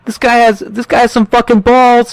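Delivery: high impressed mobster impression; mock gangster voice. Mobster sizing up Spider, hammed-up high wiseguy. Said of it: "And he goes".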